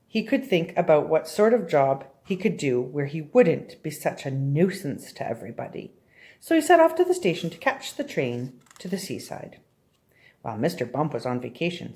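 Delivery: ordinary delivery, nothing marked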